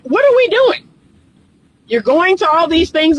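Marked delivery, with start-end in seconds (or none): 0.0-0.9 high, disbelieving squeal; incredulous. Acted. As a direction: act stunned at the absurdity. Coach mocking bad relationships.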